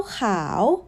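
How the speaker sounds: khao said slowly, dipping then lifting; drawn-out rising khao. Shown as a demonstration.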